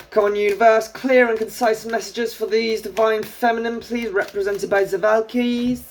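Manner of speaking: high, put-on Scandinavian-style accent; silly villain accent. Reader calling on the universe for clear messages, silly villain accent. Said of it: "I'm gonna keep doing it".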